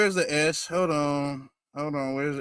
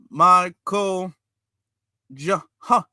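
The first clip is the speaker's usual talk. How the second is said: mock sounding out; 3 semitones lower, slower than usual. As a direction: imitate someone slowly sounding out syllables. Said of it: "he's sounding it out".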